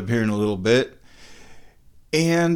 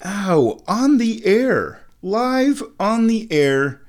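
finger pain; 8 semitones higher, slower than usual. Real, not acted. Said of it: "tea-related injury".

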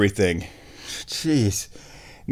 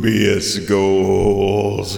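announcer voice; 3 dB louder, much slower than usual. Acted. Show title announced slowly.

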